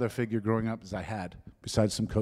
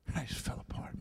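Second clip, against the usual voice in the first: choked whisper; 3 semitones higher, 13 dB quieter than usual. Real, not acted. Preacher choked up retelling his brother's embrace, whispering. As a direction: voice dropping to a near whisper. Emotional testimony of breaking down.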